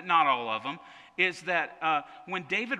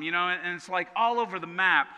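normal talking voice

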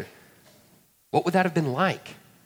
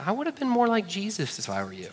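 higher, softer teasing mother voice; mock mother; 7 semitones higher, 5 dB quieter than usual. Mary gently chides James, higher and soft. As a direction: scold sweetly like a comparing mom. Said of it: "She just looks at him and says".

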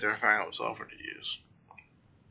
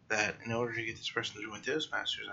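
ordinary delivery

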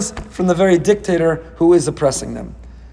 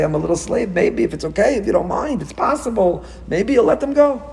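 rising higher and higher, a hint of a meek slave; timid pleading; 7 semitones higher than usual. Slave timidly asking Pharaoh, hesitant and meek. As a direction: beg hesitantly, hedging every word. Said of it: "doesn't demur ... doesn't hesitate".

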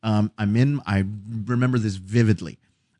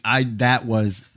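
ordinary delivery

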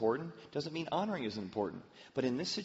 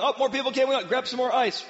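much higher, louder gushing hostess greeting; excited hostess; 11 semitones higher, 9 dB louder than usual. Retold or imitated. Hostess welcoming party guests, high and loud excited. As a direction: gush over every guest at the door.

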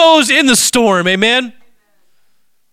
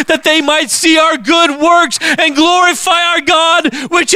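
preaching at full force; 5 semitones higher than usual. Acted. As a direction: build to a fervent sermon climax.

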